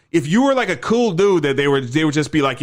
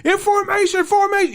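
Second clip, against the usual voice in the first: shouted command. Retold or imitated. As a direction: bark it across the parade ground. Sergeants order recruits into line.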